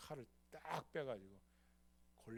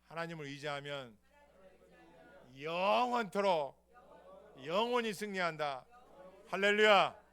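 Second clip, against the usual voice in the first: slow preached line to repeat; 3 semitones higher, 16 dB louder than usual. Shown as a demonstration. Pastor leading the congregation to repeat, slow and loud. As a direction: stretch it out slowly, loud. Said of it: "한번 따라해서".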